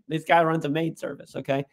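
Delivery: usual speaking voice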